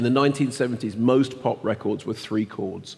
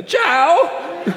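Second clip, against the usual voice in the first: high, loud laughing Italian ciao; Jared Leto Italian accent; 5 dB louder than usual. Pretend. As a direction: squeal it like a hammy Italian. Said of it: "Do it as Jared Leto".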